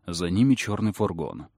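plain reading voice

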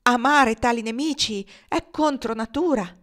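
high, loud outraged rebuttal; fierce indignation. A narrator's reading. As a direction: throw the idea back at him angrily. Woman rejects love, fierce look. (replica indignata e veemente)

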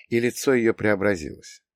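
ordinary delivery, nothing marked

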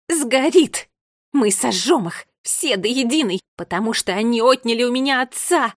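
loud, seething outburst; furious hissing. A narrator's reading. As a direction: spit each threat out in rage. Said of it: "жутким оскалом она прошипела". (громко, с яростным шипением)

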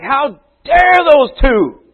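slow, high outraged cry; mock indignant. Disciples resenting the brothers, high indignant drawl. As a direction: play the offended disciples, drawn out. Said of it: "They're like".

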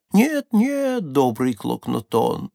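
ordinary delivery

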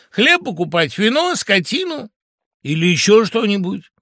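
high, loud, incredulous questioning; indignant argument. A narrator's reading. Woodpecker doubting fox's story about the village, indignant. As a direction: fire rhetorical questions in outrage. Woodpecker argues against the fox.

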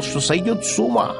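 ordinary delivery, nothing marked

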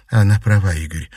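ordinary delivery

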